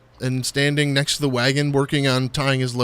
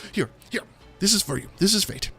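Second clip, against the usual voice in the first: lightly higher mysterious halfling; Stefan's voice; 6 semitones higher, faster than usual. Acted. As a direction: hand it over with meaning. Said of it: "he says".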